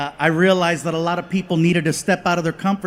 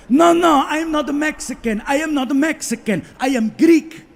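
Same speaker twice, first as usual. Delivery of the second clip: put-on higher, insistent Greek accent protest; 6 semitones higher than usual. Vendor correcting the comedian in a Greek accent, insistent. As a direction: insist in a Greek accent you're not Mexican.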